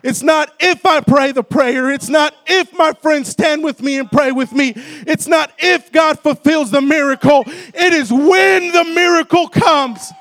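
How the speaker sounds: loud, driving revival sermon; full-force preaching. Real, not acted. Preacher urging the church to praise before the miracle, full-force preaching. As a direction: preach it hard, punching the ends of phrases.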